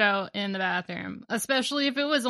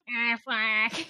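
duck voice. Pretend. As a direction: quack out the word like the Aflac duck. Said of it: "you gotta say it in the duck voice".

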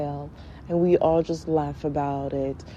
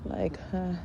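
ordinary delivery

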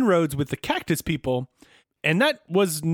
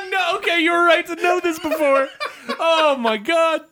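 laughing; 12 semitones higher, 3 dB louder than usual. Real, not acted. Laughing fit after grunt.

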